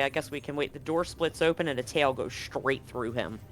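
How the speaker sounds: normal talking voice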